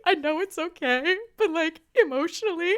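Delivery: high tearful pleading voice; teary explaining; crying. Retold or imitated. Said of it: "And I was like".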